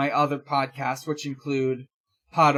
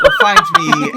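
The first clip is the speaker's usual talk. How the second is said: squeaky laugh through the words; 11 dB louder than usual. Real, not acted. Host picturing finding his own ghost, laughing. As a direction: crack up while saying it.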